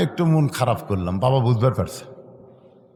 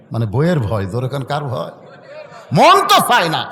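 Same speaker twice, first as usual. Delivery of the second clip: ordinary talk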